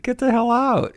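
high, delighted cry of disbelief; amazed disbelief. Retold or imitated. To a fellow former player, in high delighted disbelief. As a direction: toss it off in joyful astonishment. Learns he played there too.

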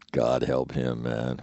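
mock grim prayer, low and quiet; a quiet low aside about a troublemaker. Watching him head out.

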